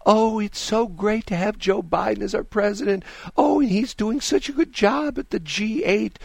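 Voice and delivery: high, gushing mock fangirl, slowing at the end. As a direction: ham up a swooning anchor. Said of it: "she's gushing about".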